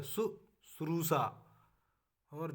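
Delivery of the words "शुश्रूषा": शुश्रूषा said slowly, शु-श्रू-षा each clear; careful demo of शुश्रूषा; slow correct form. Shown as a demonstration. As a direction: dictate शुश्रूषा syllable by syllable. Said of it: "शुश्रूषा ये शुद्ध रूप है".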